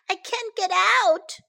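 high, drawn-out cry for help. A narrator's reading. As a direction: wail it slowly like a trapped kid. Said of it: "cried Sam".

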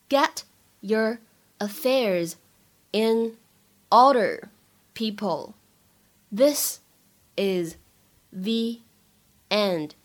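each word said alone with long pauses; slow dictation pace. Shown as a demonstration. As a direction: drill it slowly, one word at a time. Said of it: "teacher drills line word by word".

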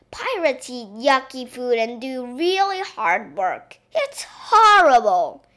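hammed-up tearful child; storybook sobbing. A narrator's reading. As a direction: wail the complaint, drawing out the end. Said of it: "she sobbed".

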